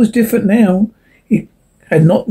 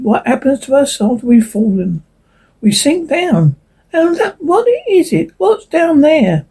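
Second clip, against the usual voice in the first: higher, searching little leaf voice; lightly voiced anxious leaf; 8 semitones higher than usual. A narrator's reading. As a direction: ask it like a worried leaf. Second leaf asks, narrator voiced.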